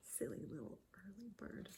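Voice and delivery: fast breathy whisper; fond whisper. A narrator's reading. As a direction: whisper it fondly. Tender aside.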